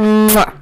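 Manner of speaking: loud, high smacked "mwah"; big kiss noise. Pretend. Host giving the requested kiss, loud mock smooch. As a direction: blow an exaggerated audible kiss.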